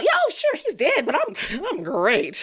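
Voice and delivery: high-pitched sarcastic fake cheer; exaggerated, high, insincere brightness; mock cheerfulness. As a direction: put on a chirpy "all fine" act, breaking into a laugh. Mocking expected cheer while grieving.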